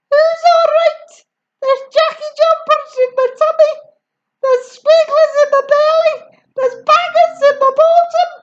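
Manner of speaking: high, loud outraged complaint; outraged yelling. A narrator's reading. Grandma complaining to George, high loud yelling. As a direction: rant about her insides. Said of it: "she yelled".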